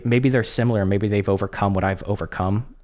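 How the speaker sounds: normal talking voice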